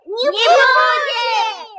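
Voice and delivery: slow, loud group reply; loud answer. Acted. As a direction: shout it back slowly so they hear. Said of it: "Не слышу".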